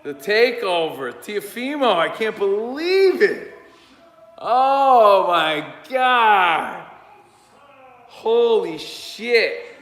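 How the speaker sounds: loud, stunned shouts of disbelief; can't believe what just happened; shocked joy. Real, not acted. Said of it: "I'm shocked".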